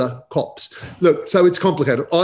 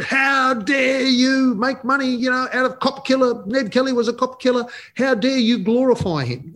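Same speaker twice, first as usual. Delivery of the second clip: indignant caller; 11 semitones higher than usual. Retold or imitated. Policeman caller berating the author on live radio, high and outraged. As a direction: play the offended cop jabbing each accusation. Irate constable phones radio show.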